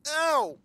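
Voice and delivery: sharp loud high ow; pained yelp. Real, not acted. Gamer yelping from his sore tailbone, loud and high.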